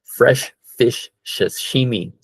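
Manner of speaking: slow tricky phrase. Shown as a demonstration. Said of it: "a little bit hard to say".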